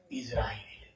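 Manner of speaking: whispered reveal; dramatic whisper. Real, not acted. Preacher revealing the answer to the crowd, whispered. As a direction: breathe the name like a secret.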